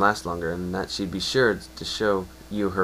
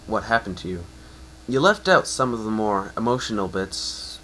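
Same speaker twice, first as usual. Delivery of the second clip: ordinary delivery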